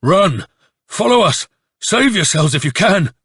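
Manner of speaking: loud, high, urgent warning cry; booming shout. A narrator's reading. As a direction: shout it out to fleeing men. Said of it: "shouted out in a booming voice".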